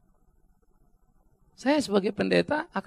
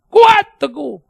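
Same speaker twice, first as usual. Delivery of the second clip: loud exhortation; 7 semitones higher, 11 dB louder, much slower than usual. Real, not acted. Preacher exhorts.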